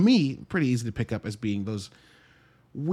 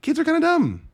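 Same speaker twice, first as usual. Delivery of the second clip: much higher and louder, hammed up; mock exasperated; 7 dB louder than usual. Real, not acted. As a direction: throw it out high and loud for laughs. Exaggerated repeat of dumb claim.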